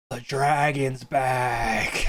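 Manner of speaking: slow, drawn-out dazed stoner voice; stoned bar patron. Acted. High guy voiced by game master.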